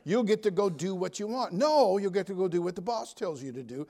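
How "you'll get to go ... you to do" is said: ordinary talk, nothing marked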